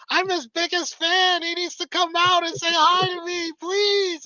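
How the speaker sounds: very high, pleading falsetto shriek; imitated fan screaming. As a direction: beg hysterically like an obsessed fan. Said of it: "she's just screaming".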